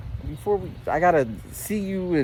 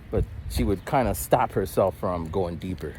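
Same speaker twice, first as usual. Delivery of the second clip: usual speaking voice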